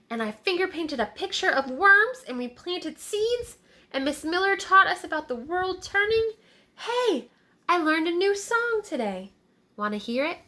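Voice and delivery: put-on high eager kid; excited little boy. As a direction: kid bursting with news. Tommy chattering to the animals.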